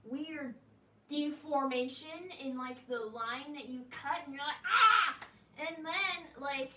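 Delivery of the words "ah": sharp high "Ah!" of alarm; loud startled cry; startled yelp. Retold or imitated. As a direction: yelp at the ruined cut. Reacts to ruined cut.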